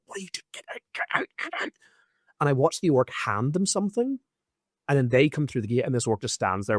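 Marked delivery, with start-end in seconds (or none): 0.1-1.7 frantic whispered "get out" under the breath; urgent hushed warning. Retold or imitated. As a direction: hiss it urgently, barely voiced. Urgent warning to straggler, retold.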